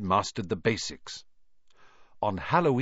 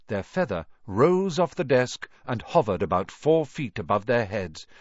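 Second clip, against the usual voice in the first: ordinary delivery, nothing marked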